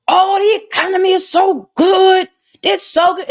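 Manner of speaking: high, far put-on voice gushing over the economy; mock the cheerful newscasters in a high voice; mocking news anchors. Vlogger mocks TV news hosts, gushing in a high put-on voice. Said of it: "everybody's like".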